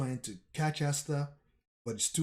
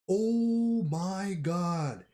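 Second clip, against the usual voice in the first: amazed; 5 semitones higher, much slower than usual. Real, not acted. Awed by the huge sword cut.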